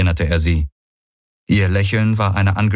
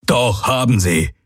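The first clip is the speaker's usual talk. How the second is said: slow, higher-pitched emphatic reply; firm, drawn-out retort; 5 semitones higher than usual. A narrator's reading. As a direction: call the contradiction back, word by word. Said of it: "gab Hawks zurück".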